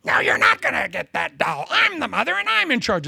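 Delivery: hammed-up shrieking mother at a four-year-old; mom screaming at child. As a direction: play the mother losing it in Walmart.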